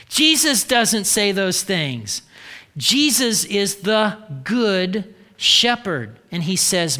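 usual speaking voice

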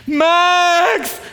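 high, loud cry of the name; joyful shout. Retold or imitated. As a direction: call out bursting with joy. Friend calls at midnight, overjoyed.

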